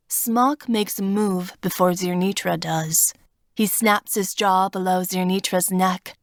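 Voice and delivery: plain reading voice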